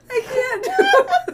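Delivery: breaking into helpless laughter; giggling too hard to finish; laughing. Real, not acted. Laughing fit.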